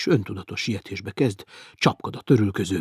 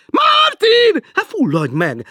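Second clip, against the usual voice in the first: much higher, loud and slow nagging; shrill teasing woman; 12 dB louder, much slower than usual. Acted. Woman below teasing Martin, shrill and slow. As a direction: drag it out like a shrill landlady.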